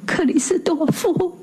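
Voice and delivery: high, hushed and shaking; trembling voice. A narrator's reading. Husband pleads with Christophe, voice trembling. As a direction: let the voice quiver on the name. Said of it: "伯罗姆声音发抖了".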